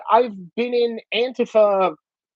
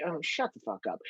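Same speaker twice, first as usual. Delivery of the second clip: much softer, dismissive aside; muttered retort; 2 semitones lower, 17 dB quieter than usual. Retold or imitated. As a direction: toss it off under your breath. Muttered retort to media claims.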